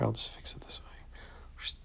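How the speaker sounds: hushed self-talk; soft whispered aside; slow whisper. Real, not acted. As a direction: whisper it slowly to yourself. Fixing mistake.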